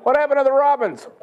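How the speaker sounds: loud, very high-pitched mock question; alarmed child imitation. Grandson asking grandpa about the birds, mock high child voice. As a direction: cry it out like a startled kid.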